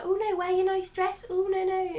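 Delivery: high-pitched, playful fussy protest; mock fussing gentleman. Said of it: "he'd be like".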